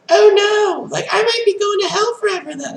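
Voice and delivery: very high, loud panicked squeal; mock panic. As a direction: squeal it in exaggerated, put-on dread. Voicing a dressed-up person panicking.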